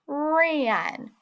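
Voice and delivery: "ran" stretched out as one word; slowly blended word. Shown as a demonstration. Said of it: "say the word slowly".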